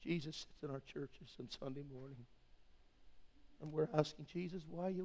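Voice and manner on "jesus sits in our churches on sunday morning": usual speaking voice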